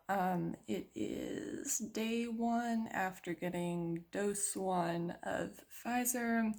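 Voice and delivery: soft, slow sleepy talk; sleepy morning voice. Real, not acted. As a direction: talk slow and drowsy, just woken. Morning after, tired, soft slow.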